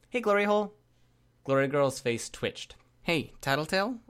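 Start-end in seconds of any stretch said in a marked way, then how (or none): 0.1-0.8 high, loud, sing-out hello; taunting greeting. A narrator's reading. Tattletale greets Glory Girl with an insult, high and taunting. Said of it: "then greeted Glory Girl". 3.0-4.0 higher-pitched, slightly tense hail; strained call. A narrator's reading. Captor hails her teammate, a touch strained. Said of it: "I called out, my voice a touch strained".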